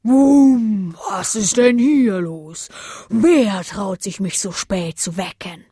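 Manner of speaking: slow, loud, booming bellow; drawn-out angry roar; roaring big wind. A narrator's reading. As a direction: bellow like a giant woken from sleep. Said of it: "brüllte".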